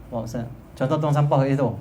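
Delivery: ordinary talk, nothing marked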